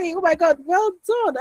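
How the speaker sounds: mother overjoyed at her shy daughter's first reply; delighted praise. Retold or imitated. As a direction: cheer the child on with delight.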